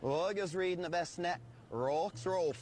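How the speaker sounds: "August reading the best net, rocks roll" as Lincoln's line spoken backwards; backwards speech. Shown as a demonstration. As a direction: say the phrase reversed sound by sound. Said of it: "Fourscore and seven years ago".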